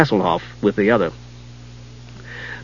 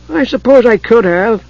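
kender voice; 9 semitones higher than usual. Acted. Tas's voice in the story.

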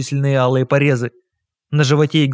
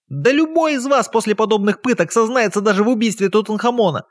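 high-pitched shriek, much higher; 8 semitones higher than usual. Acted. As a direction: shriek it out in outrage. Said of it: "перешёл на визг". (визгливый крик)

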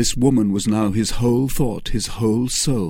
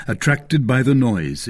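ordinary delivery

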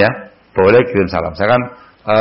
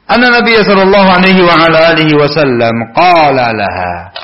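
bacaan hadis; 5 semitones higher, 3 dB louder than usual. Acted. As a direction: bacakan sabda dengan khidmat. Membaca matan hadis.

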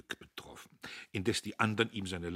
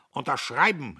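loud, higher command; calling out; 6 semitones higher, 10 dB louder than usual. Acted. The major orders the men to sign: loud call. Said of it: "rief der Major".

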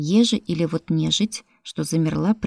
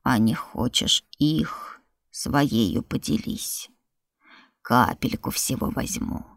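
creature voice; 5 semitones lower, slower than usual. Acted. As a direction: coax it out slowly like a spirit. Creature bargains, low slow.

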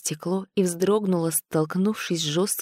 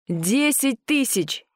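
drawn out, higher and slow; slow, insistent old man; 7 semitones higher, slower than usual. A narrator's reading. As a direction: push the offer slowly. Antiquarian keeps raising the offer.

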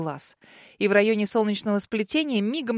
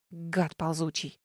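muttered insult; 5 semitones lower, 8 dB quieter, slower than usual. Acted.

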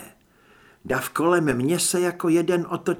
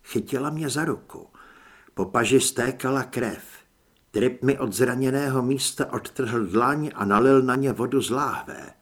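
ordinary delivery

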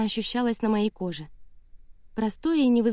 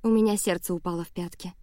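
ordinary delivery

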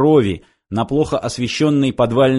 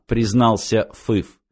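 ordinary delivery

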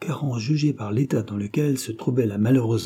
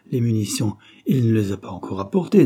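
plain reading voice

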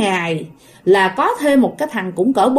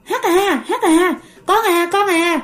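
lightly acted, high repeated calls; imitated calling; 7 semitones higher, slower than usual. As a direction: mimic someone calling out to a child. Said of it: "cái người đó mới kêu".

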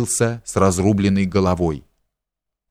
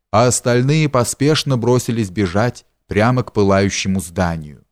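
usual speaking voice

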